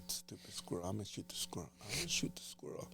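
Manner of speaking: irritated hunter's whisper; whispered grumble at a squirrel; annoyed whisper. Retold or imitated. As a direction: hiss it under your breath. Retold annoyed whisper in stand.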